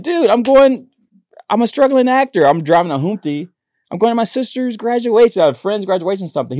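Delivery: high-pitched, pleading excuse. Retold or imitated.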